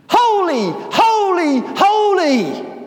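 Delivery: shouted high and loud, ringing out; booming angelic cry of holy. Acted. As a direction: proclaim it like angels shaking the doors. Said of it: "Not that loud. Loud enough to make the doors shake".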